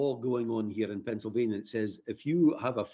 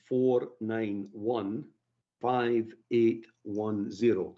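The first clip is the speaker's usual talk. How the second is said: slow, spaced-out digits; careful dictating pace; slow dictation; much slower than usual. Real, not acted. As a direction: read the number out slowly for listeners to copy. Dictating phone number slowly.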